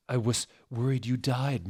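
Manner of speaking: plain reading voice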